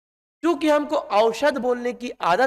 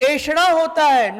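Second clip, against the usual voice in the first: ऐषणा said slowly, higher and louder; teacher emphasis; 6 semitones higher, 5 dB louder than usual. Shown as a demonstration. Teacher stresses the correct form ऐषणा to students, slow and loud. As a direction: hammer home ऐषणा for the class to underline. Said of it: "ये underline करो".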